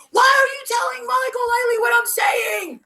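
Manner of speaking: high-pitched retold shriek; freaked-out screech. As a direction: imitate someone screaming in a freak-out. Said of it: "Jill freaked out on Katrina".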